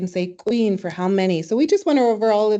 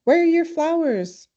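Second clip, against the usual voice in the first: aunt asking; 9 semitones higher than usual. Retold or imitated. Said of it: "she was asking me in the language".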